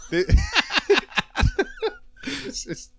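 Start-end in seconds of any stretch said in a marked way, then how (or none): 0.3-1.9 sudden high giggle; high laugh. Real, not acted. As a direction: break into laughter. Cracks up mid-sentence.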